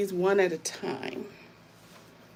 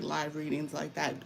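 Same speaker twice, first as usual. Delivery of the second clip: normal talking voice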